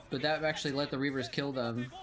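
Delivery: ordinary talk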